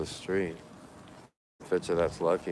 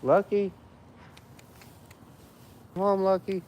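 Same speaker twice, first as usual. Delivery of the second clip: much higher sing-song call; calling the cat; 13 semitones higher than usual. Real, not acted. Searching the street for Lucky, high calling voice.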